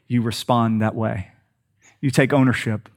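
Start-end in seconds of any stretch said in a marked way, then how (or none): none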